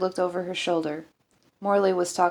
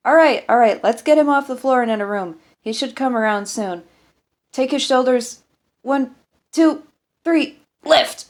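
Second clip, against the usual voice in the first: higher and louder, taking charge of the lift; commanding shout; 6 semitones higher, 8 dB louder than usual. Acted. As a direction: bark out the count to lift him. Doctor directs lifting the patient.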